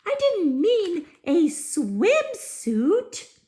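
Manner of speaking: high, slow fairy voice; fairy dismay. Acted. Fairy dismayed at the swimsuit, high and slow. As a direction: let the little fairy sigh over her mistake. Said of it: "thought the fairy".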